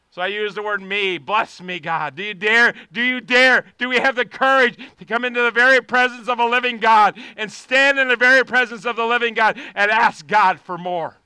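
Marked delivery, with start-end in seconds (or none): 2.1-11.2 shouted, rising prayer at full volume; fiery pulpit prayer; preaching at full force. Acted. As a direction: cry out to God to stretch you.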